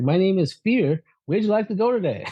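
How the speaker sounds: a sly driver asking where to, high and unhurried; cab driver named Fear. Retold or imitated. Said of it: "He's like".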